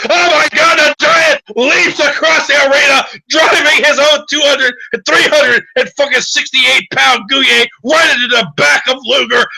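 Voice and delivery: screaming play-by-play, way up high and loud; hyped commentator. Acted. GM calls the Giant's leap to players, screaming hype. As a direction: call the big leap like a frantic wrestling announcer. GM hyping wrestling move.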